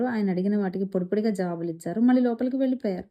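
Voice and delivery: ordinary delivery, nothing marked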